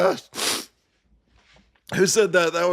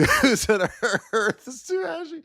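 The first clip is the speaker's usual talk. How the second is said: laughing; 9 semitones higher than usual. Real, not acted. Speaks through laughter.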